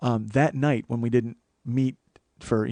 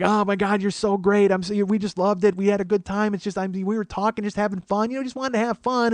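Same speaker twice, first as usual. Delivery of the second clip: put-on drunk gushing friend; 7 semitones higher than usual. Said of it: "She's drunk".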